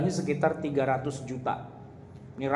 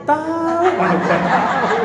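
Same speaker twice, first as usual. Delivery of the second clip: high, loud, laughing through the words; laughing reply; 10 semitones higher, 9 dB louder, slower than usual. Retold or imitated. Imam tells the preacher he has no idea, laughing loudly. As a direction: laugh it off, like a guy who has no clue.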